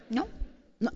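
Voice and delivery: usual speaking voice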